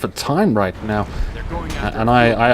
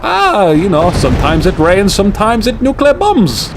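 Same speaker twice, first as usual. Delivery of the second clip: higher, mock German accent, laid-back shrug; a clumsy, jokey German accent; hammed-up soldier shrugging in a German accent; bad German accent; 7 semitones higher than usual. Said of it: "not the best German accent".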